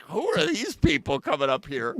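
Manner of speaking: a retold, half-joking shout of surprise; surprised mock shout. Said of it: "I'm like".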